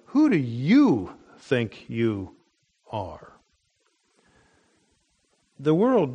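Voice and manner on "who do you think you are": slow, scornful challenge leaning on "you"; contempt and scorn. Acted. Preacher voicing the council questioning Peter, with contempt and scorn. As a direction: look down on them, drawing each word out. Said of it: "with contempt and with scorn".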